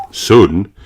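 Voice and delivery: ordinary delivery, nothing marked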